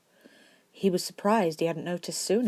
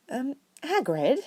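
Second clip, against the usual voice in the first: timid Hermione; 9 semitones higher, slower than usual. A narrator's reading. Hermione nervously interrupts.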